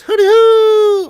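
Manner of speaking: a huge, high, slowed hollered hooty hoo; hooty hoo shout. Pretend. Streamer reads a viewer's greeting as a whooping shout. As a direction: holler it like a goofy battle cry.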